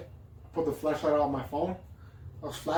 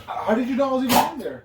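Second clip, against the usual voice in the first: surprised; 9 semitones higher, 7 dB louder than usual. Real, not acted. Roommate confronted about creeping.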